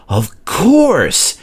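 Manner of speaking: high, loud excited burst; excitedly. A narrator's reading. Fireman interrupting Duncan, high and excited. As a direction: jump in with sudden eagerness. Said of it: "interrupted the fireman excitedly".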